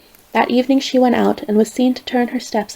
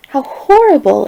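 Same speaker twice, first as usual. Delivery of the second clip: higher, louder cry of disgust; horrified exclamation; 5 semitones higher, 5 dB louder than usual. A narrator's reading. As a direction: recoil at the idea. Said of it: "exclaimed Fantine".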